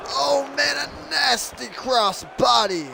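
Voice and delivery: high, slow, shouted call; excited shout. Real, not acted. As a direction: yell the move out in awe. Commentator reacting to cross body.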